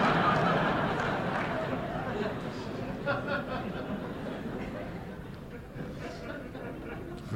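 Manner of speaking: long breathy real laugh, high and soft. Real, not acted. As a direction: laugh it off at length. Speaker laughs at own bitterness.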